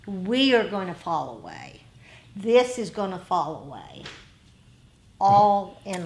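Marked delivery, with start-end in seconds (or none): none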